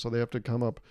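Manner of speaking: ordinary delivery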